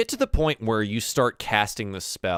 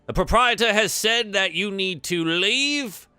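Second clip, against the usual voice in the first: higher-pitched, urging halfling ushering someone out; officious bouncer; 6 semitones higher, slower than usual. Acted. Halflings ushering a patron out of the bar, officious. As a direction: shoo them out in a raised, put-on voice.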